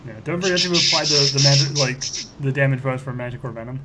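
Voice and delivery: usual speaking voice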